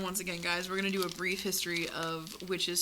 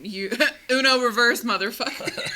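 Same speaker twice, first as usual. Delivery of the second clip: loud comeback; 4 semitones higher, 10 dB louder than usual. Acted. Acted comeback in witch spat.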